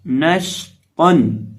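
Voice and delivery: नशतन very slow, long श and thick त; heavy taw. Shown as a demonstration. Teacher modelling the word, slow नशतन with heavy त. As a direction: stretch the श in नशतन, then land a full-mouthed त. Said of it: "तो को मोटा करके पढ़ना है".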